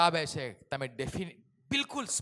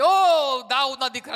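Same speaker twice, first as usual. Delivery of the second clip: loud, high desperate call; crying out; 8 semitones higher, 15 dB louder than usual. Acted. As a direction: call out to Jesus from the roadside. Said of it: "ઈસુ ખ્રિસ્તને બૂમ પાડે છે".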